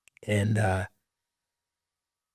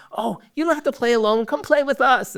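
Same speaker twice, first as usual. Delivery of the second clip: put-on high, bright little-boy voice, much higher; a small child eagerly inviting a lonely kid to play; imitating his three-year-old son, high and sweet; voicing his little son; 12 semitones higher than usual.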